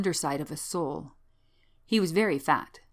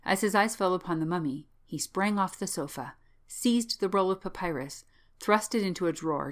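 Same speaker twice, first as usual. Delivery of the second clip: plain reading voice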